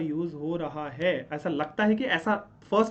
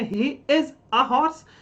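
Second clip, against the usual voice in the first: slow wrong example; 4 semitones higher, 5 dB louder, much slower than usual. Shown as a demonstration. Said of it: "तो is नहीं लगाना है".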